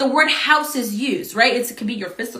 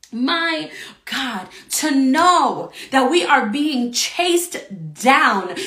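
ordinary talk, nothing marked

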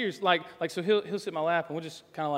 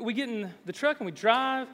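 ordinary talk

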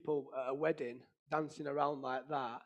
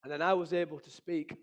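usual speaking voice

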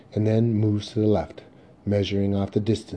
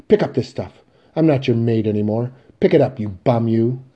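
loud, insistent scolding; angry command; 3 semitones higher, 9 dB louder than usual. Acted. Mother refusing to be the sons' maid, angry command. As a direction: order them about in a raised voice. Mother orders sons to pick up.

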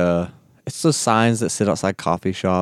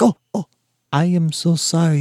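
apologetic waiter; 6 semitones higher than usual. Retold or imitated. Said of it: "He's like".

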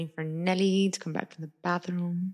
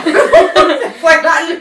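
loud laughing cry; 11 semitones higher, 17 dB louder, much slower than usual. Real, not acted. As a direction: laugh it out loudly. Power cuts out mid-video.

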